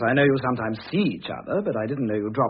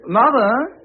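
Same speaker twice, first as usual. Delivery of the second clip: loud, high call toward the door; 10 semitones higher, 8 dB louder than usual. Acted. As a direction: call out to her as she comes in. Son calling out to arriving mother.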